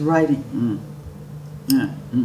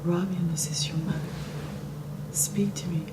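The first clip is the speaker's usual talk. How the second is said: soft whispered calming mother; calming mommy voice; 3 semitones lower, 12 dB quieter than usual. Retold or imitated. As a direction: hush it gently to a silent daughter. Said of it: "my most calming mommy voice".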